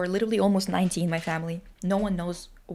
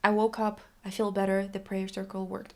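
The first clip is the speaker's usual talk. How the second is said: ordinary delivery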